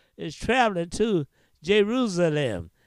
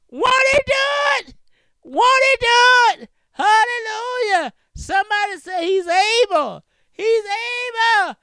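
high, loud fervent preaching; preaching at full force; 10 semitones higher, 7 dB louder than usual. Acted. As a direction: rouse the congregation, ringing out.

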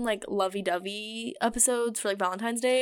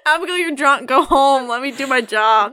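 loud; 4 semitones higher, 9 dB louder than usual. Real, not acted. Scolding drunk co-host, taking over.

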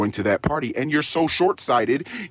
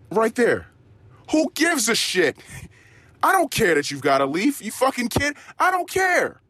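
mock outrage; 10 semitones higher than usual. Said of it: "ranting about the leaf".

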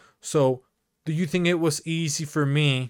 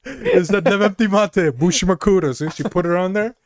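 high, laughing through the story; 5 semitones higher, 5 dB louder than usual. Real, not acted. Retelling the brick note to the cohost, laughing high. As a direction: keep laughing as you tell it.

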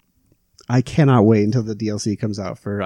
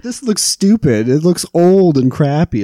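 mocking kids; 6 semitones higher, 3 dB louder, slower than usual. Kids mocking the old game.

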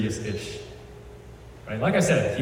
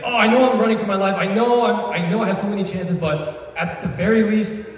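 eager David; 8 semitones higher than usual. Retold or imitated. David hoping aloud to be king, eager. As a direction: bubble over about becoming king.

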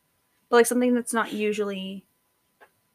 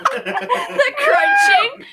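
laughing; 10 semitones higher, 8 dB louder than usual. Real, not acted. Grossed out by crouton image.

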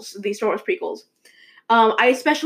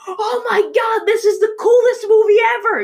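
gushing superfan; 11 semitones higher, 5 dB louder than usual. Acted. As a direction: imitate an over-the-top fan, pitched way up. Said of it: "a fan of, like".

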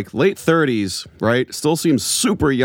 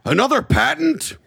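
much higher, mock angry "Another patent?"; mock outrage. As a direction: ham up the boss's outrage. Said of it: "Was he that mad?".

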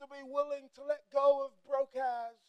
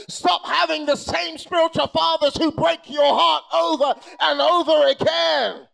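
full-force preaching; 2 semitones higher, 8 dB louder than usual. Real, not acted. Preacher to congregation, loud driving preaching. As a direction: drive each line home at full force.

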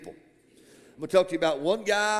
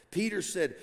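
normal talking voice

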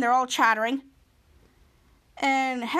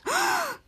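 sharp gasp, much higher. Retold or imitated. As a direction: gasp in shock, high up. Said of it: "And Charlotte gasps".

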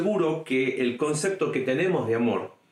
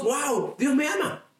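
mock amazement; 11 semitones higher than usual. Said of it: "y decimos".

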